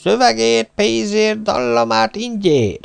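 high-pitched street showman's pitch; hawker's patter. A narrator's reading. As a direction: sell it to the crowd in a high, calling voice. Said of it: "kiáltotta a férfi".

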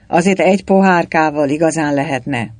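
plain reading voice

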